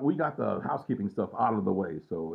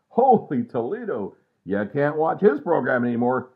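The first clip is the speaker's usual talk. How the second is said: exclaiming; 4 semitones higher, 9 dB louder than usual. Real, not acted. Exclaiming about Archie Bunker.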